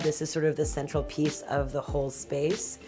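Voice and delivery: ordinary talk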